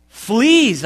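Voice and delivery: a sudden high, loud yelp of disgust; alarmed cry. A narrator's reading. Corey discovering fleas in the bunk, crying out loud. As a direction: shout it out as the bugs bite. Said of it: "I cried".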